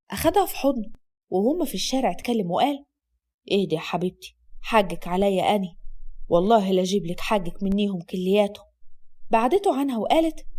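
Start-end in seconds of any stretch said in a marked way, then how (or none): 3.5-8.7 soothing. Acted. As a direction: hold her and calm her. Asad soothing low.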